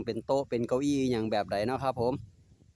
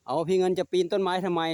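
scolding pet; 7 semitones higher, 4 dB louder than usual. Real, not acted. Scolding pet climbing tree.

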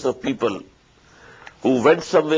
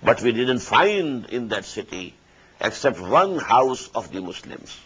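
ordinary talk

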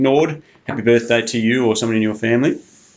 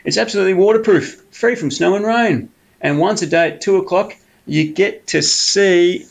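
higher, coaxing sly fox voice; 8 semitones higher than usual. Acted. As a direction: sweet-talk the bear like a cunning fox. Fox sells the tunnel.

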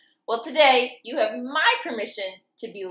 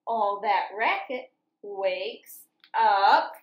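lively sing-song picture book reading; reading to children; 5 semitones higher, 4 dB louder, slower than usual. A narrator's reading. Teacher reading farm story to children, lively sing-song. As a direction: read it bouncy, like for little kids.

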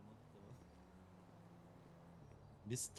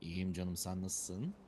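deep slow voice; 5 semitones lower, 6 dB quieter, slower than usual. Pretend. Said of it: "Ananı sikim o ses ne?".

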